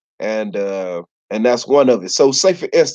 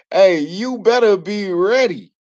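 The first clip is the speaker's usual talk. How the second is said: mock warning; 6 semitones higher than usual. Warning stranger greeting.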